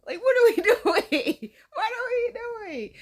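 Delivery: high, loud helpless laugh; laughing disbelief. Real, not acted. Host baffled by the date's mothering, laughing hard. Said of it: "laughing disbelief".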